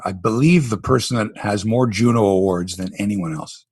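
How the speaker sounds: ordinary delivery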